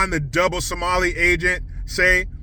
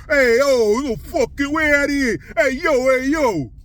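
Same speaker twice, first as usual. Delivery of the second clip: New York street guys; 7 semitones higher than usual. Retold or imitated. Mocking angry New Yorkers shouting at the new mayor. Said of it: "What would they".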